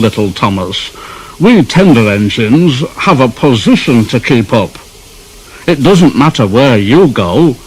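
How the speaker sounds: loud, haughty and self-important; pompous Gordon. Acted. Gordon lecturing little Thomas, loud and dignified. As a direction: puff yourself up like a grand engine. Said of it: "in a dignified manner".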